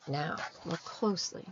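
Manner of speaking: usual speaking voice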